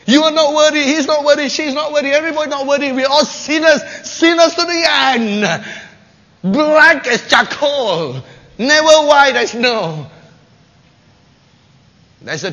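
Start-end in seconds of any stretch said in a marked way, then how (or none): 0.1-10.1 loud, higher condemning proclamation; harsh voice of the law. Acted. Preacher voices the law condemning all sinners, loud. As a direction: thunder the law's verdict.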